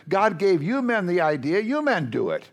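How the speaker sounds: ordinary talk, nothing marked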